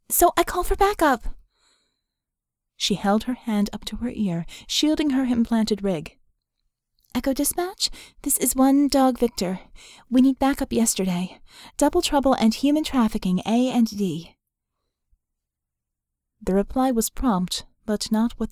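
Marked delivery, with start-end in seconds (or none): 0.1-1.4 anxious. Acted. Vicky anxious, pitch jumps. 7.1-14.4 radio call. Acted.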